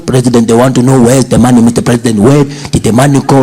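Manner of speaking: mock ANC comrade nagging about the money. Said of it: "picturing him ... ANC comrades".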